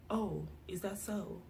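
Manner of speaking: soft imitation of an American teacher's accent; hushed American-accented teacher. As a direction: say it quietly in a put-on American accent. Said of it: "let me do it in her accent".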